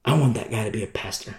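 quick, quiet, low-pitched divine decision; a hint of God deciding quietly; the Lord. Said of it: "And so what does he do?".

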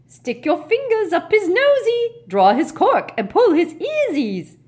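high, loud poltergeist chant; squealing. Acted. Peeves egging on the elves from the air, squealing. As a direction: squeal mischievous orders at the top of the voice.

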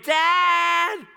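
put-on very high, loud child's yell; imitated kids' yell. Frightened kids left in the dark, yelling for their father. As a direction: scream out like a frightened kid. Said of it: "They would start yelling my name".

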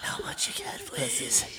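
soft, unvoiced mock begging; hushed teasing imitation; pleading whisper. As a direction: whisper it as a desperate, nosy plea. Mimics the host's eager nosy stare.

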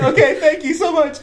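high, giddy thanks; imitated freaking out. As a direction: gush in a hammed-up high voice, half laughing. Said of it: "I'm, like, freaking out".

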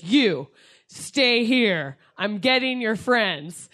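slow, emphatic mock command; good Samaritan. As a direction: imitate a rescuer giving orders, word by word. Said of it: "some good Samaritan was like".

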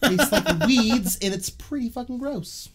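high, slow, grossed out; disgusted. Real, not acted. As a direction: pull a face at the taste. Disgust at spring mix.

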